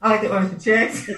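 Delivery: mocking her own polite bank request; laughing. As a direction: put on a prim voice while laughing. Mock prim request, laughing.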